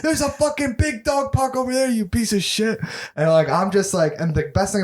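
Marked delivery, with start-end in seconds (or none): 0.0-2.8 much higher mock-furious rant at another owner; hammed-up outraged yelling, pitched way up; angry dog owner. Acted quarrel of dog owner.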